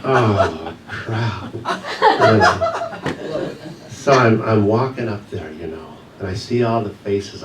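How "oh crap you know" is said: laughing through the words. Real, not acted. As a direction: say it while still laughing. Caught by the teacher.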